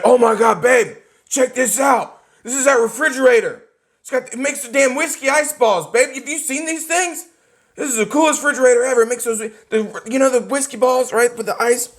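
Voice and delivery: put-on a grown man giddy as a kid; thrilled husband. Husband showing his wife the fridge, giddy and excited.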